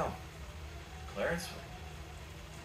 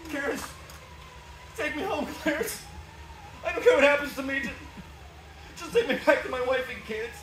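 high, anguished begging; desperate pleading; 8 semitones higher than usual. Acted. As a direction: plead with all you have left. George begs to return.

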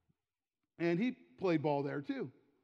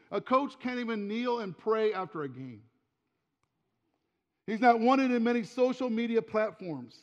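loud, building sermon delivery; rally the congregation; full-voiced preaching to a church; fervent preaching; 2 semitones higher, 3 dB louder than usual.

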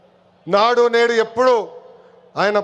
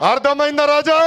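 higher-pitched call, "Raja" held out; rousing taunt; 4 semitones higher than usual. Real, not acted. Leader repeating his taunt for the rally crowd, rousing call. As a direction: throw "Raja" up high to the crowd.

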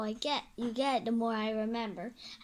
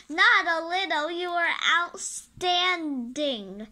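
loud, high insistence; emphatic protest; 5 semitones higher, 6 dB louder, slower than usual. Acted. Friend praising the hero, loud and insistent. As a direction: push back hard on the modesty.

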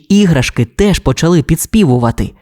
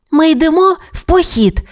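put-on high-pitched happy little girl; joyful announcement. A narrator's reading. Girl announces a camping trip to her toys, joyfully. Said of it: "радісно оголосила Бонні".

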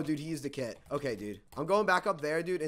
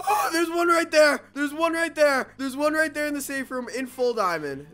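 high, loud urgent callout; excited shout; 12 semitones higher, 9 dB louder than usual. Real, not acted. Gamer shouting to viewers about an enemy, alarmed. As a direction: yell it out as you spot him. Spots enemy in safe room.

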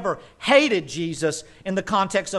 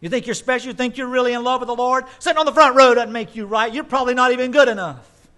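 higher, louder, fast mock rebuke; hammed-up pulpit scolding, hurried and higher; lightly voiced scolding preacher; 6 semitones higher, 5 dB louder, faster than usual. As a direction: rattle it off like a sneering legalist. Voicing the legalist preacher scolding.

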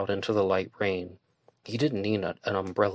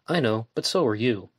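ordinary delivery

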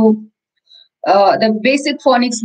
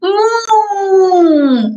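high bright moo, pitched up; mooing rabbit; 6 semitones higher than usual. Acted. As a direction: moo like a little rabbit pretending to be a cow. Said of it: "And rabbit says".

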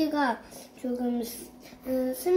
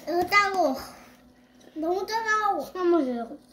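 speaking with noodles in mouth; muffled by food in mouth; slurping noodles while talking; 6 semitones higher than usual. Real, not acted. Seen 1: Noodles hang from the child's mouth while slurping through the words.

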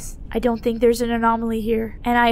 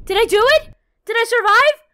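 high, louder breathless questions; excited hope; 9 semitones higher, 6 dB louder than usual. Real, not acted. Player hoping he escaped the train, excited high questions. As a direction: ask it full of hope. Reaching possible exit.

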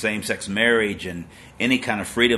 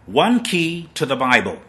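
a raised, weighty quote of the preacher; loud mock-solemn reading; quoting the preacher; 5 semitones higher, 6 dB louder than usual. To his listeners, quoting John Piper in a loud solemn voice. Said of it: "He says".

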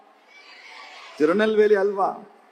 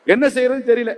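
ordinary delivery

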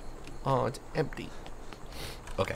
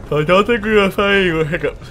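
hammy announcer voice; 7 semitones higher, 10 dB louder, faster than usual. Pretend. Mocking announcement voice.

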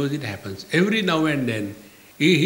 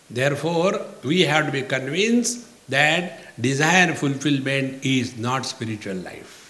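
normal talking voice

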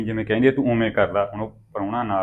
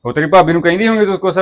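loud question; 8 semitones higher, 8 dB louder than usual. Acted. Brother questions sister loudly.

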